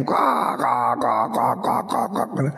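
forced cawing laugh; mock crow laugh. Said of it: "guyune digawe-gawe". (tawa dibuat-buat seperti gagak)

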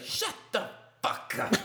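hammed-up little-kid cry without full volume; imitated cursing kid. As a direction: mimic the kid's strained outburst. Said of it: "He said that perfect".

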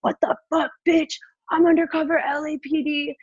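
imitated high-pitched furious outburst; high, indignant retold rage; raging man. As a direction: mimic an enraged guy yelling at her. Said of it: "like, raging".

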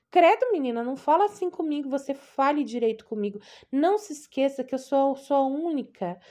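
offended, possessive aunt; scolding aunt. Acted. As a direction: scold her, then cling to her. Aunt scolding, then clingy.

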